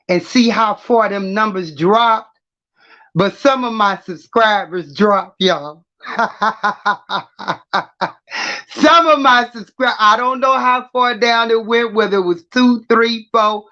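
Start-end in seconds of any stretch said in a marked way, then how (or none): 6.0-8.1 real laugh. Real, not acted. Laughs about subscribers dropping.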